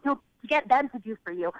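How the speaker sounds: ordinary talk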